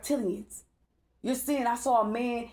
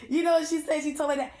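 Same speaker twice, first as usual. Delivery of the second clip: ordinary talk, nothing marked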